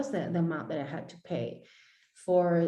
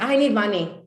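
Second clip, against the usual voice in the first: louder annoyed snap; 3 semitones higher, 7 dB louder than usual. Retold or imitated. Annoyed client answering coach, louder. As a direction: bark it out, fed up. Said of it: "very annoyed".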